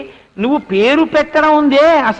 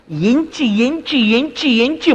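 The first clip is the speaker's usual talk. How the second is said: lilting ఎంచి, each one rising and falling in the same wave; rhythmic repetition; much slower than usual. Real, not acted. Preacher on the careful picking of gems, rhythmic repetition. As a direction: chant ఎంచి over and over in an even swing.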